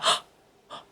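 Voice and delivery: breathy, fast, fretful dog voice; whispered panic as a dog, lightly voiced; worried dog voice. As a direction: voice the anxious dog rushing to check. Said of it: "she's like".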